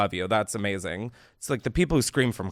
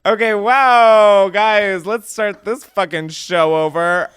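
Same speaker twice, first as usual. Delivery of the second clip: flustered outburst; 6 semitones higher, 7 dB louder, slower than usual. Real, not acted. Host flustered after awkward voicemail.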